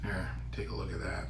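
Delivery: soft, hushed whisper. Real, not acted. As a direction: lean in and whisper it. Showing the food close up.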